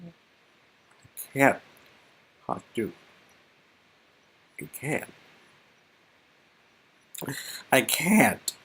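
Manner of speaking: a man giving up in despair; broken Damien. Acted. Damien breaking down.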